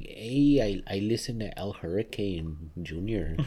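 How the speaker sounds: mock New Mexican Spanglish lilt; New Mexican accent. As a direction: play a caricature New Mexican. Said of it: "New Mexican Spanish".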